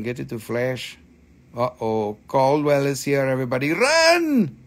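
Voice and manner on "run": sudden high yelp of Run; mock alarm. Host warns viewers as Caldwell arrives, mock panic yell. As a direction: jokingly sound the alarm.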